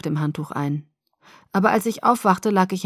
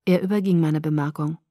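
usual speaking voice

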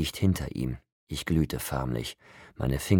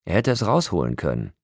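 angry; 6 semitones higher, 3 dB louder than usual. A narrator's reading. Jacob's angry follow-up.

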